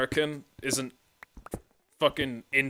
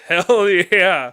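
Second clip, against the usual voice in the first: loud, high whoop through a laugh; laughing cheer; 7 semitones higher, 13 dB louder than usual. Real, not acted. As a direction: cheer it out with gusto. Laughing patriotic cheer after joke.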